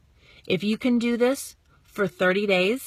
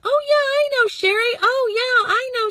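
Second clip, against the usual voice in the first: much higher, louder mock gush of recognition; neighbors gushing; 7 dB louder than usual. Townsfolk who all know Sherry, gushing much higher. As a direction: squeal it like the whole town knows her. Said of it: "everyone's gonna".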